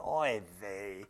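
low, weary Yiddish sigh; Yiddish accent. Retold or imitated. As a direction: sigh it like a tired Yiddish grandpa. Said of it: "Jesus is going".

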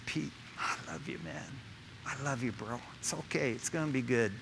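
very soft, low, almost whispered comfort; tender reassurance. Acted. Preacher voices Jesus forgiving Peter, hushed tender tone. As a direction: reassure a failed friend gently, barely voiced.

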